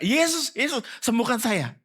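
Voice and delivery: high desperate plea for healing; crying out. Retold or imitated. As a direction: call out to Jesus, pleading. Blind men crying out to Jesus.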